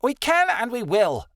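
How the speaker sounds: high, sharp snap; snapping. A narrator's reading. Rebecca overrules Adelaide about going home, snapping. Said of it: "Rebecca snapped".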